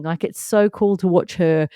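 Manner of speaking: normal talking voice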